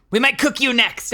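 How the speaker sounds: loud, high goblin threat; hungry goblin eyeing a meal. Acted. Goblin threatening to cook the visitor, loud goblin voice. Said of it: "Why is the goblin Rick".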